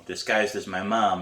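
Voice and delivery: ordinary delivery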